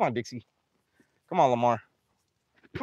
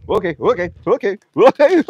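okays climbing higher and louder; rising panic; 7 semitones higher, 6 dB louder than usual. Real, not acted. Feeder crowded by big animals, increasingly panicked. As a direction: repeat it faster into a real nervous squeal.

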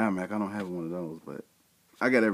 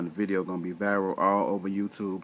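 ordinary delivery

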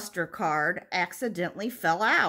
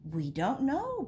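lightly voiced bees, higher pitch; buzzing bees; 5 semitones higher than usual. A narrator's reading. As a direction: answer as story-time bees, lifting at the end. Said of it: "buzzed the bees".

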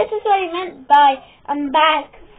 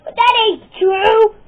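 higher, louder comeback; indignant protest; 7 semitones higher, 8 dB louder than usual. Acted. Wrestler answering a rival's insult, loud indignant protest. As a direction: protest loudly against the taunt.

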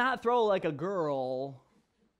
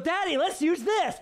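put-on high, loud little-girl glee; imitated excited child; 12 semitones higher, 6 dB louder than usual. Daughter offers chair to throw at Daddy, imitated excitement. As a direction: squeal the idea like an eager toddler.

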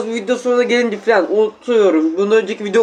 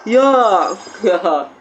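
ordinary talk

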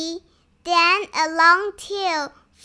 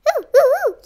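high, swooping little oohs; fluttery high cries of delight; 8 semitones higher, 4 dB louder, much faster than usual. A narrator's reading. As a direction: coo excitedly in high bursts. Excitement at butterflies everywhere.

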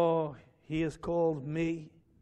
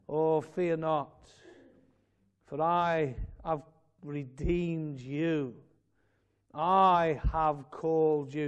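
"I" leaned on slowly and heavily; emphasis on I; much slower than usual. Acted. Preacher to congregation, slow weighty stress on I. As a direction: stretch the verse, leaning hard on "I". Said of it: "You see the emphasis?".

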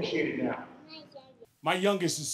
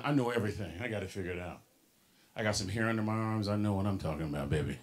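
a hint of a know-it-all teenager, lower; cocky teen; 11 semitones lower, 6 dB quieter, faster than usual. Speaker mocking his teenage self to the audience, lightly smug teen. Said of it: "I'm sixteen. Translation".

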